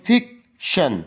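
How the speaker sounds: "Fiction" slowly in two beats, fik... shon; broken into syllables. Shown as a demonstration. Teacher giving students another example, "fiction" broken in two. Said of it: "ভেঙে ভেঙে পড়বার জন্য যে কৌশল".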